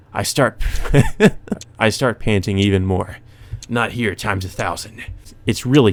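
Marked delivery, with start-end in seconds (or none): 0.7-1.7 a sudden high, loud burst of crazed laughter; unhinged laugh. Acted. Killer losing control in the empty street, crazed high laugh. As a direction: let a manic laugh break out mid-sentence.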